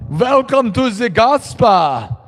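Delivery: high, drawn-out vampire greeting; hammed-up Transylvanian-style vampire accent. Streamer pitching a goth spa welcome, vampire accent. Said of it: "with a vampire accent".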